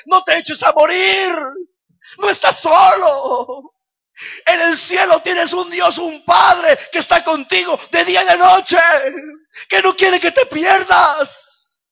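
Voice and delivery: shouted, high, slow pleading encouragement; encouraging brother. Retold or imitated. Brother lifting up a downcast man, shouted encouragement. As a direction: cry out hope to a broken man. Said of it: "llegó un hermano y me decía".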